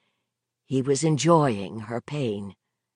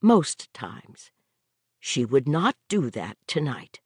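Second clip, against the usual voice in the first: plain reading voice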